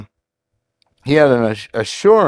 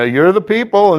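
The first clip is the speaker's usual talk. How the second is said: high-pitched mock concession, lightly sarcastic; Job, sarcastic; 7 semitones higher, 3 dB louder than usual. Preacher paraphrasing a retort to accusing friends, sarcastic. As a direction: grant it with mild sarcasm, pitched up. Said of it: "he's being pretty sarcastic here".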